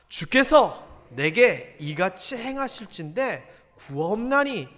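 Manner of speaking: scripture reading. Real, not acted. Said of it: "한번 읽어볼까요".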